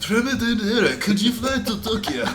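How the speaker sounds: high, put-on Japanese accent for a joke; hammed-up Japanese accent, pitched well up; mock Japanese accent. Podcaster jokingly calling his photographer to Tokyo, mock Japanese accent. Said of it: "good impression of an accent".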